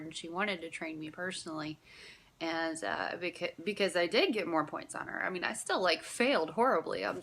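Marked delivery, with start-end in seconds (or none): none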